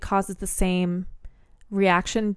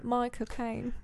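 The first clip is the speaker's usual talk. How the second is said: 1 Michael Caine accent; 2 semitones higher, 7 dB quieter, slower than usual. Shown as a demonstration. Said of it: "in Michael Caine's accent".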